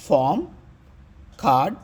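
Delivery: ఫామ్ and కార్డ్ with a long vowel and no r; silent middle R. Shown as a demonstration. Teacher demonstrating the rule, ఫామ్ and కార్డ్ without any r. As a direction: leave out the r in కార్డ్, say "kaad". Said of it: "దేని తర్వాత ఆర్ వచ్చినా అది silent".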